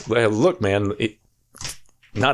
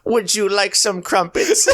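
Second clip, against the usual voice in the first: high, slow posh voice with a laugh; Family Guy Churchill; 9 semitones higher, slower than usual. Retold or imitated. Offering crumpets in a silly Churchill voice. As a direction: ham up a pompous Englishman. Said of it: "I'm doing the Family Guy bit".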